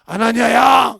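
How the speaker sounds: loud summoning call; the Lord calling Ananias loudly. Retold or imitated. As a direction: call his name across a distance. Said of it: "그래서 뭐래".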